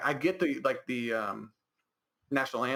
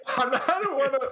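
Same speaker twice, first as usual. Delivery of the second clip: laughing; 11 semitones higher, 3 dB louder than usual. Real, not acted. Laughs at own emotion.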